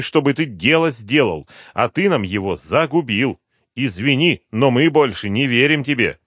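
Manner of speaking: high, loud gloating threat; menacing general. Acted. General threatens the trapped colonel, loud. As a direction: lord it over him.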